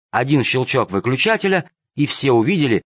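usual speaking voice

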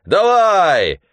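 high, loud yell; gleeful yell. Acted. Mitka yells at the waves while swimming. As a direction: roar at the wave. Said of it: "орал, когда на него катилась волна".

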